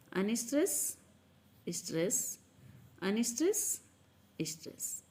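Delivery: "Unstress" up, pause, "stress" dropped and soft, each ending on a rise; alternating stress pattern. Shown as a demonstration. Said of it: "इस तरह से होता है".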